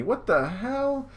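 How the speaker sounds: high, drawn-out comic disbelief; shocked. Acted. Groom sees unexpected guests, shocked high exclamation. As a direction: exclaim it slowly, voice shooting up.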